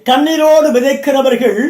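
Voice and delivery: slow, ringing scripture proclamation; preaching. Real, not acted. Preacher calling out the psalm verse, slow proclamation. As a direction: proclaim the verse slowly to the crowd.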